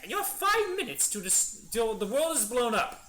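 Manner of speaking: high, loud cartoon villain threat; Frieza. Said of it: "I don't think I can do a Frieza voice".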